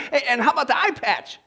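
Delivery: sailor voice. Acted. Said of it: "He says".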